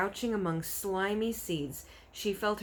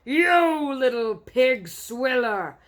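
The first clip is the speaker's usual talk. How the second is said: slow, much louder furious roar; 7 semitones higher, 12 dB louder, much slower than usual. Acted. Bloodbottler raging at the BFG. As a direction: roar the insult with rage. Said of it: "roared the blood bottler".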